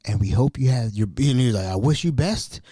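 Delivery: ordinary talk, nothing marked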